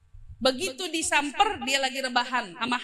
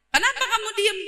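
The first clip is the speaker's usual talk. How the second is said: angry scolding; 8 semitones higher, 4 dB louder than usual. Acted. Angry mistress scolding servant.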